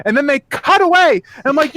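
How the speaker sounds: normal talking voice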